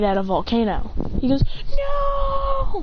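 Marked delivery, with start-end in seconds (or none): 1.7-2.8 long high drawn-out "no"; high acted wail; despairing cry. A narrator's reading. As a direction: cry out as if falling. Teleported to a volcano.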